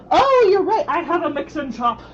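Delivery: sudden high loud burst; excited. Real, not acted. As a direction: jump in, suddenly delighted. Sudden reply to chat, excited.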